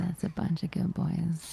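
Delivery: slow breathy whisper. Acted. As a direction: whisper it slowly and seductively.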